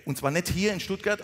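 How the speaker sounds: usual speaking voice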